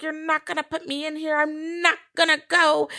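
a hint of a possessed Deadite mother; a bit like a Deadite. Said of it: "She sounded like a Deadite a little bit".

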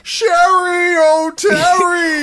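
loud, high, drawn-out call; shouted catchphrase. Acted. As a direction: belt it out like a sketch.

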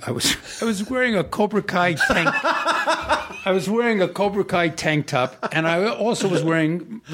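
laughing while talking; amused, laughing through it. Real, not acted. Laughs recalling his Cobra Kai outfit.

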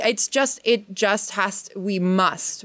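normal talking voice

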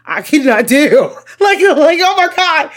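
high, loud, laughing squeal; hammed-up horrified reaction. Reliving a disgusting movie scene for co-host, mock-horrified shriek.

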